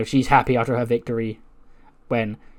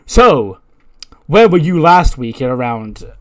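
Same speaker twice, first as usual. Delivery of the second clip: loud, accusing interrogation; acted confrontation; 9 dB louder, slower than usual. [name] corners a suspect against the wall, loud and accusing. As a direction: open on a big loud word, then press.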